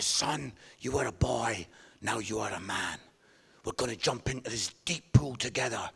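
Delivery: low, lightly voiced solemn dad; mock-solemn father. Father declaring his son a man, lightly played solemnity. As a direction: make a grave little rite-of-passage speech.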